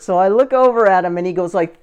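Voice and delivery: normal talking voice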